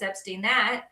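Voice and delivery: ordinary talk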